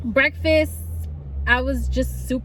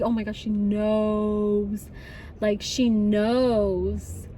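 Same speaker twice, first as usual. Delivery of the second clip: scared; 3 semitones lower, much slower than usual. Retold or imitated. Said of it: "I was scared".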